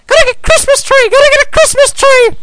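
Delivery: put-on very high, loud, fast child's shout; Roo shouting. A narrator's reading. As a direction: yell it like an excited little joey. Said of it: "while Roo shouted".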